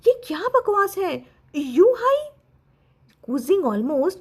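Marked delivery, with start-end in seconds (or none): 0.1-2.3 nearly exploding at the news; sharp angry rise on the name; sharp outburst. A narrator's reading. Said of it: "कू जिंग का tone थोड़ा sharp हो गया".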